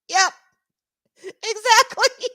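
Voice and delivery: high, slow, laughing the word out; real tearful laugh. Real, not acted. Streamer agreeing with chat while still laughing.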